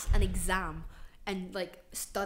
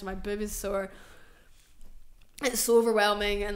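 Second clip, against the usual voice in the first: ordinary delivery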